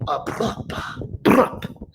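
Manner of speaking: unvoiced, soft brup; whispered French brup. Teacher does a French brup for the students. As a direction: breathe the brup out like it's French. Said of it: "that's French brup".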